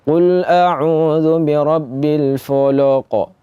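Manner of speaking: Qul a'udzu birabbil falaq recited with the mouth half closed, falaq as folo; wrong recitation. Shown as a demonstration. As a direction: chant it with a closed mouth, vowels dark. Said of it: "Mulutnya tidak terbuka dengan benar".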